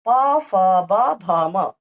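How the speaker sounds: each letter drawn out very slowly and loud; slow letter recitation. Shown as a demonstration. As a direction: stretch every letter for the class. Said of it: "प वर्ग का आया ये". (हर वर्ण बहुत धीरे खींचकर बोलना)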